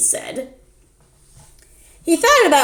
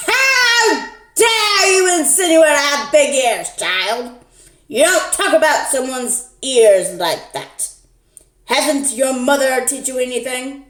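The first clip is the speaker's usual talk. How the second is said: higher, louder indignant granny; offended scolding; 5 semitones higher, 4 dB louder than usual. Acted. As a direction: scold the child like an outraged granny. Offended fake grandma scolds.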